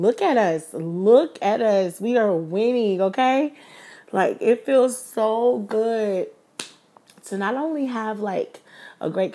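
rally everyone, we're winning; loud triumphant look at us; hyped celebration. Real, not acted. Hyped celebration of their success.

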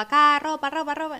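loud opening market cry, then easing off; market shout. Retold or imitated. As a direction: call out like a stall seller. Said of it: "la gente gritaba".

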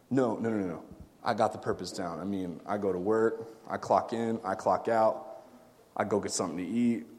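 low, quiet, routine-bound monotone; mock self-satisfied churchgoer, voice dropped; complacent churchgoer. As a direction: play someone content in their routine, softly and low.

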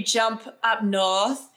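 amateur Northern English accent, drawn out; slow, hammy attempt at Northern English. Shown as a demonstration. Podcaster showing off a Downton Abbey accent, mock Northern English. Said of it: "That's my Northern English accent".

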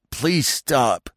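high, pleading whine; pitiful whine. A narrator's reading. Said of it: "whined Ronno pitifully".

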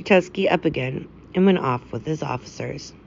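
ordinary delivery, nothing marked

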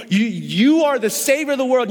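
normal talking voice

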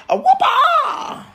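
high, slow stretched-out shout; hammed-up shout. Stoner playing the bragging movie villain, big shout. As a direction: belt it out like a cartoon brag.

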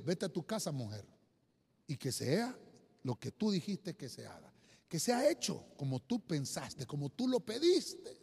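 Eli's gentle blessing. Acted. El sacerdote Elí bendice a Ana, voz baja y tranquila. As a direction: send her home with quiet reassurance. Eli blesses Hannah, voiced.

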